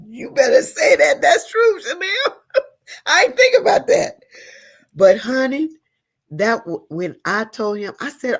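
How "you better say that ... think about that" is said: high, loud, laughing agreement; delighted laughing. Real, not acted. Woman to Chanel about power in three, laughing. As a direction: exclaim it through real laughter.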